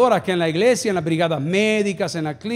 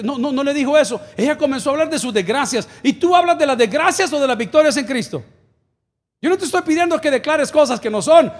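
raised-pitch, driving sermon delivery; forceful preaching; 5 semitones higher, 4 dB louder than usual. Real, not acted. Pastor challenging believers about the Samaritan woman, forceful preaching. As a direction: press the point on the congregation.

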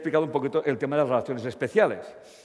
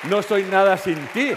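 louder, higher exasperated mock outburst; fed-up complaint; 4 semitones higher, 6 dB louder than usual. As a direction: throw the line back, sick of it. Same line, now weighing you down.

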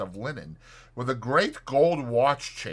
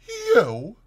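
high-pitched, startled question; startled disbelief; 12 semitones higher, 6 dB louder than usual. A narrator's reading. Doctor faces his old acquaintance, startled and incredulous. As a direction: blurt it in shocked recognition. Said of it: "in a startled whisper".

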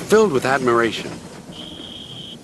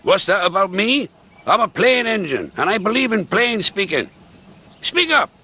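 higher, blunt grumpy engine voice; gruff Duncan; 9 semitones higher than usual. Acted. Duncan speaks.

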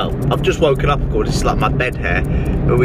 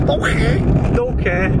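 dismissive retort; 8 semitones higher, slower than usual. Acted. Brushing off imagined objection.

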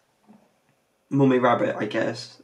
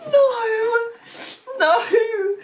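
high laugh-cry protest; helpless laughter; 13 semitones higher than usual. Real, not acted. Hearing the badungadungs line, high laugh-cry. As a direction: beg it to stop while laughing.

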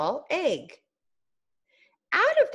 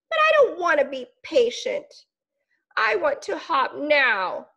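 whiny caterpillar; 7 semitones higher than usual. Acted. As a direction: sulk like a child who wants it now. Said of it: "Caterpillar whined".

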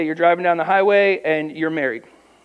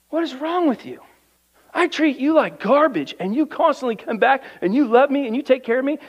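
high, incredulous husband, raised pitch; imitating an exasperated husband, pitched up; mocking husband voice; 6 semitones higher than usual. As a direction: throw up your hands as the baffled husband. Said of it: "the husband looks at her and goes".

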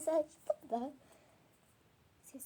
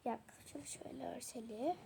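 ordinary talk

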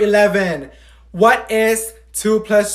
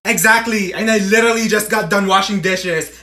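usual speaking voice

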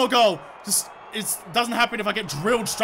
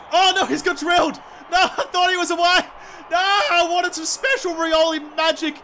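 high, raised-voice protest at the game; dismayed outburst; 6 semitones higher than usual. Real, not acted. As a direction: wail in dismay as the play falls apart. Dismay as game play fails.

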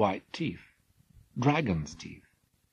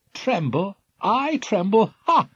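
scornful, higher, proud scoff; grandly; 11 semitones higher, 7 dB louder than usual. Acted. Jason scoffing at the king's warning, grand. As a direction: laugh off the danger. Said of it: "said Jason grandly".

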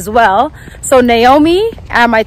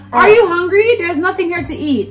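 higher-pitched mock Jamaican patois; imitated patois; 5 semitones higher than usual. Niece imitates uncle offering food, in put-on Jamaican patois. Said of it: "I don't even talk like this".

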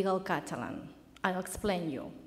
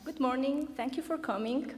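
higher, lifted greeting and thanks; high-pitched opening; 5 semitones higher than usual. Shown as a demonstration. Student restarts the presentation for the coach, high-pitched. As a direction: open up high. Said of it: "you need a high pitch".

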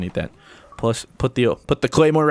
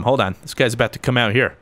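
ordinary talk

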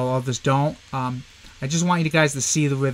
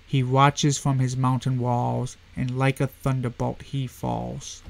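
slow, measured recital of a poem; slow poetry recital; poem reading; 2 semitones lower, 4 dB quieter, slower than usual. Shown as a demonstration. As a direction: read it like verse, unhurried.

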